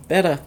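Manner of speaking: "Betta" repeated softly as BET-uh; corrected pronunciation. Real, not acted. Fishkeeper repeating the correction, "Betta" as BET-uh. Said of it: "It's actually pronounced betta".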